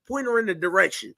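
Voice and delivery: ordinary talk, nothing marked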